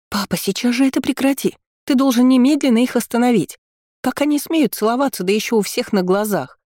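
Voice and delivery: put-on high, shrill-edged girlish outcry; envious exclamation. A narrator's reading. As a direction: cry out in jealous outrage. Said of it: "Мэри завистливо воскликнула".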